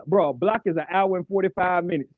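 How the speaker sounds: ordinary talk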